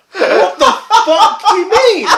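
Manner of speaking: high, slow and laughing; laughing disbelief. Retold or imitated. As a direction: ask it while cracking up. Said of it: "I was like".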